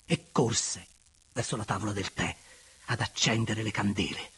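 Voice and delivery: plain reading voice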